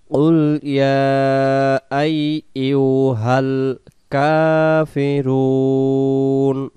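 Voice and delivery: slow melodic tajweed chant; Quran recitation. Shown as a demonstration. Teacher reciting the verse for students, slow chant. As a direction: recite slowly, stretching each vowel.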